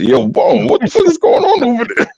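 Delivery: very high, incredulous mock outcry; retold alarm. Retelling his reaction to Bone on the phone, high-pitched alarm. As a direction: squeal the question in disbelief.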